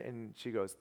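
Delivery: ordinary delivery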